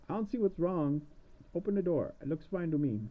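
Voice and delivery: mildly puzzled witch; witch not yet panicked. Acted. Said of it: "getting kinda nervous".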